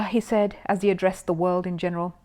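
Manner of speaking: usual speaking voice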